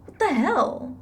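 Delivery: outraged disbelief. Real, not acted. Player learning the dog was taken, indignant outburst. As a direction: blurt it out, genuinely appalled. Hears she took the dog too.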